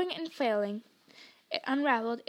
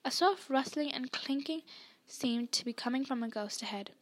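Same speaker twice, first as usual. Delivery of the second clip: ordinary delivery, nothing marked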